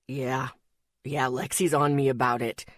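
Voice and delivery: low, mild grumble; lightly voiced sick young man grumbling; grumbled. A narrator's reading. Zeke complains to Rory about Lexi, grumbling. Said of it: "Zeke grumbled".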